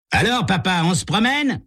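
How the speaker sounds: put-on high cocky teen jeer; taunting call. Tommy taunts the driver next to them, high and cocky. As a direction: jeer at the old man across lanes. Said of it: "cria Tommy".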